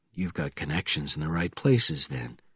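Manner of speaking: low, soft, cool remark; quiet, unruffled. A narrator's reading. Bourne answering the screaming sergeant, cool quiet voice. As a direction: keep it quiet and controlled.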